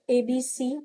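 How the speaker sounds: usual speaking voice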